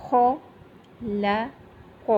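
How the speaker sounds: خلق with a heavy خ, normal ل and heavy ق; slowly, heavy خ and heavy ق, even vowels; heavy kha. Shown as a demonstration. Teacher showing heavy letters in خلق. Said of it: "خو جو ہے یہ موٹے حروف میں سے ہے".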